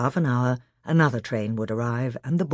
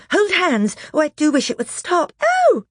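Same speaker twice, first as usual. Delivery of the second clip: high, loud desperate pleading; terrified girl wishing it stopped; frightened girl; 6 dB louder than usual. Acted. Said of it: "said Susan".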